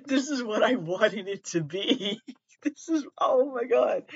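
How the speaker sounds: high voice squeezed by laughing; helpless laughter. Real, not acted. Podcaster delighted by silly book reviews, helplessly laughing. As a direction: gasp it out through real giggles.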